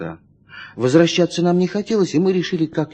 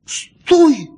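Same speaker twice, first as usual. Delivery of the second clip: higher and louder, sharp commanding shout; 8 semitones higher, 5 dB louder, slower than usual. Acted. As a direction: snap the order at the girl. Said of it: "резко сказал я". (резкий окрик, громко и высоко)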